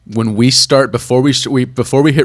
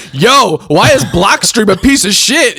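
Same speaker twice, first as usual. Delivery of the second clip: higher-pitched, blurted mock question; blunt mock outburst; 7 semitones higher, 3 dB louder than usual. Co-host ambushing guest out of nowhere, blunt mock outburst.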